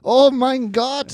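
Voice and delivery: high, drawn-out German accent; a loud, stretched German-accented exclamation. Shown as a demonstration. Comic reviving an old stage bit on request, German accent. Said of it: "German one".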